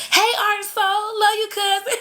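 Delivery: high bright shout-out with a laugh; excited greeting. Real, not acted. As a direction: call out to a viewer with delight. Greeting a viewer by name.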